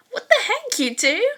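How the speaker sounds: sudden high, loud what the heck; shocked squeal at the two boys; surprised outburst. Acted. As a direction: blurt it out in disbelief. Startled classmate outburst.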